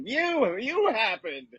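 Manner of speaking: high, pointed mock accusation; mock band retort. Bandmates blaming [name], high mock accusation. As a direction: jab back at him in a hammed-up voice. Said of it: "they're like".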